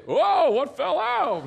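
high, loud, drawn-out fake amazement; mock surprise. Covering embarrassment over the fallen tract, hammed-up surprise. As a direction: play up innocent astonishment, hammed-up and loud.

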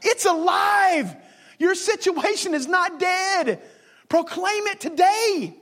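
high, slow proclamation; slow proclaiming preacher. Acted. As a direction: declare it slowly like a prophecy.

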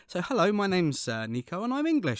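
cheery introduction in an English accent; own English accent. Shown as a demonstration. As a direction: switch into English for the reveal. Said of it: "drop into my own voice".

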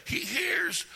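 loud, high, shouted exhortation; preaching at full force. Acted. Preacher urging the church to shout to God, roaring. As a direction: whip the congregation up.

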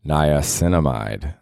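Niacinamide stretched out slowly, each syllable clear; slow careful pronunciation. Shown as a demonstration. Host practising the skincare word, slow and deliberate. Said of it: "She said it's pronounced niacinamide".

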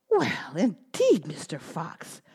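relieved gingerbread man. Acted. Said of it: "said the gingerbread man".

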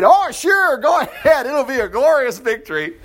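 high, sing-out sarcastic agreement; mock enthusiasm. Micaiah telling the king to go to war, mock enthusiasm. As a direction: overdo the cheery yes, plainly insincere.

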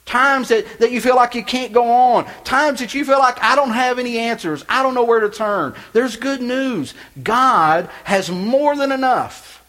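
loud, high impassioned preaching. Acted. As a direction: preach it out with full force. Preaching at full force.

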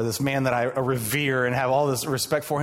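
normal talking voice

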